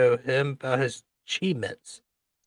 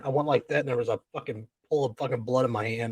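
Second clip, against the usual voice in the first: ordinary talk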